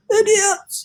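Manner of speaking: very high, loud insulting yell. Acted. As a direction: bellow it across the sky. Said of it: "he yelled at the cloudmen as loud as he could".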